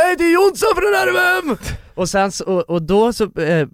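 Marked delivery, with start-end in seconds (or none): 0.0-1.6 high-pitched fan greeting from the car; excited fan. Retold or imitated. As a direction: hype it up like a starstruck lad. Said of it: "Och de bara".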